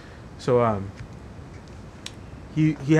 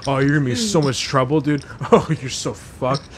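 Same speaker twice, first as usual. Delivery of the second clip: slow gloating taunt; 3 semitones lower than usual. Retold or imitated. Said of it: "he's like".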